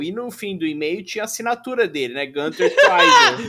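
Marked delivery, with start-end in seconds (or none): 2.3-3.5 very high, loud, slow and laughing; grand name reveal. Pretend. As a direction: announce the name like a trumpet call.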